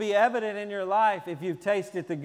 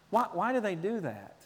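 ordinary talk, nothing marked

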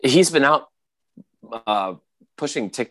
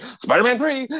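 eager pipe-up. Retold or imitated. Garfield's eager turn.

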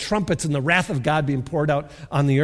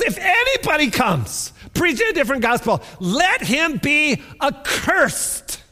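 emphatic repeat; 8 semitones higher than usual. Acted. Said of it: "he says it again".

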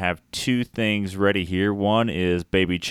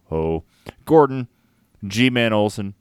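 normal talking voice

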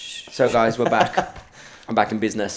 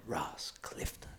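hushed whisper of a name; 4 semitones lower, 16 dB quieter, slower than usual. Retold or imitated. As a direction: whisper it like a secret. Retelling a secretive invitation.

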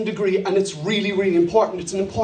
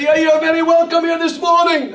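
put-on higher, louder South African accent; 6 semitones higher, 3 dB louder than usual. Pastor welcoming a visitor in a South African accent. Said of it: "my South African accent".

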